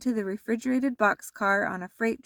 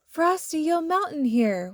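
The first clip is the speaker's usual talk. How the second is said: warning; 7 semitones higher than usual. A narrator's reading. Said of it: "warned Karen".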